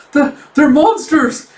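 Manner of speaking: high, loud, slow terrified cry; terrified shout. Acted. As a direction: scream the warning in terror. Soldier fleeing monsters.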